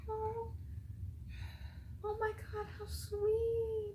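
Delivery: soft, high-pitched "aww" voice; touched cooing. Real, not acted. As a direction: coo over something sweet. Touched by a sweet scene.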